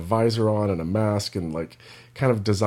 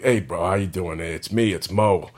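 lightly put-on East Coast city accent; Philly or New York accent; 2 semitones lower than usual. Imagined park dog greeting a bro, light Philly or New York accent. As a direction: do a dog's dialogue with a hint of New York. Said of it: "a Philly accent or a New York accent".

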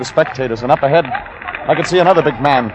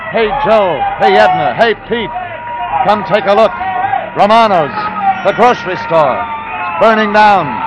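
crowd shouts; 8 semitones higher than usual. Acted. Narrator voices shouting crowd, shouts heard.

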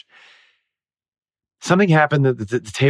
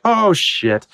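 high startled yelp; startled oh shit; 9 semitones higher than usual. Retold or imitated. Actress on stage spotting the runaway rolling pin, startled. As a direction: blurt it out in shock. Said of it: "and I'm like".

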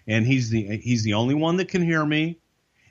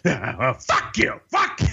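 mock angry boss; slower than usual. Vince tearing up the card.

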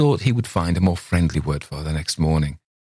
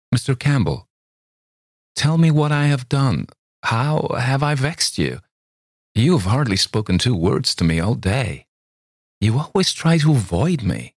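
voiced higher, a hurt young lady; young woman pleading; 7 semitones higher than usual. Young woman asks Campbell why he avoids her, pleading. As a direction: reproach him gently, lifted and wounded.

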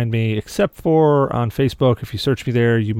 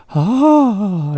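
high, drawn-out "aah"; imitated cry of pain; 9 semitones higher, 3 dB louder than usual. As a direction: mimic someone hit by deafening noise. Imitates brother's cry at deafening volume.

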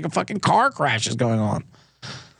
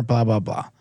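dismissive blah; 9 semitones lower, slower than usual. Real, not acted. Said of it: "I was told not to say blah".

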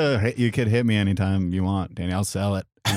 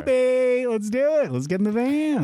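mock seductive invite, pitched way up; sweet-talking bandmate into the van; flirty high come-on; 13 semitones higher than usual. Mock sultry pitch to a comedy partner.

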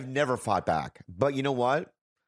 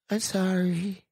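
cowering apology; 8 semitones higher, slower than usual. Retold or imitated. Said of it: "And I remember going like this".

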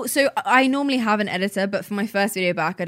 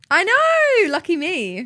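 excited; 10 semitones higher, much slower than usual. Real, not acted. Guest celebrates being over covid, excited.

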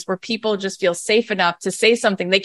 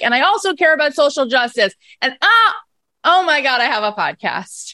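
surprised; 6 semitones higher than usual. Retold or imitated. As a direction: squeal at the realization. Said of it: "I'm like".

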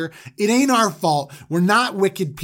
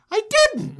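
much higher, whiny little denial; squeaky denial. Retold or imitated. Judah denying the theft to his brothers, high and whiny. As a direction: squeak out the denial like a guilty kid. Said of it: "And Judah's like".

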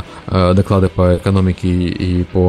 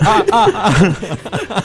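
high, slow, laughing shocked stammer; mock electrocuted cry; 10 semitones higher, much slower than usual. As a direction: ham up getting zapped. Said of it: "И ты такой".

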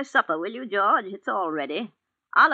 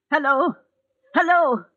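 louder, higher hello into a dead phone; rising anxious calls; 8 semitones higher, 9 dB louder than usual. Acted. Old woman calling into a dead phone, getting frantic. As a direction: call out higher and louder each time.

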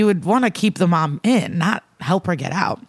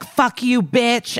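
higher-pitched mock outburst; retold angry curse; 6 semitones higher, slower than usual. Retelling dad cursing at the mother, mock angry.

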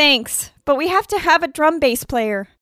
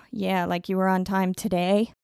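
quiet sarcastic aside; muttered sarcasm; 9 semitones lower, 9 dB quieter than usual. Acted. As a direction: mutter it under your breath. Sarcastic aside under breath.

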